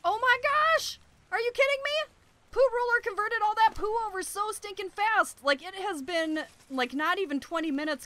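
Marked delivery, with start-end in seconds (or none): 0.0-5.4 high, excited disbelief; amazed exclaiming. Real, not acted. Gamer amazed the beetle converted the poo so fast, high pitched. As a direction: gush with astonishment.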